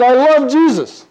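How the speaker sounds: slow, high, loud declaration; imitated woman's outcry. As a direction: cry it out slowly as the distraught woman. Retold distraught woman's final declaration.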